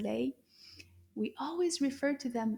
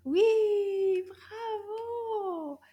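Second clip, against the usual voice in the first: excited praise; 9 semitones higher, 5 dB louder, much slower than usual. Real, not acted.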